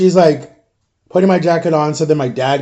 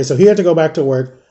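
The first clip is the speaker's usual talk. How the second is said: ordinary delivery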